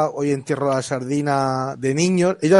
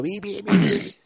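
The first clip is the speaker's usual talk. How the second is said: whistle imitation; 5 semitones higher, 4 dB quieter, much slower than usual.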